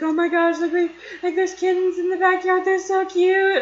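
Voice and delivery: high, loud excited gushing; nerding out. Retold or imitated. Kid on stairs with dog gushes about kittens, re-enacted nerding out. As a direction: gush over cuteness, re-enacting giddy excitement. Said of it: "I was just nerding out about how cute they were".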